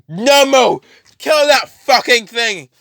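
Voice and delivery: high, loud shouted order; acted enraged shout; furious command. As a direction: yell the order, stretching the words. Villain yells an order.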